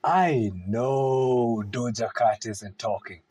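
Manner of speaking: normal talking voice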